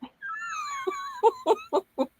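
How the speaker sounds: high, helpless burst of laughter; co-host laughing at the late-file story; real laugh. Real, not acted. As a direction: laugh out despite yourself. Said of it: "I don't mean to laugh".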